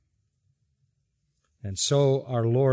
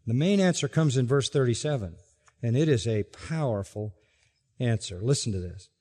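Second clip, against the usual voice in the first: ordinary delivery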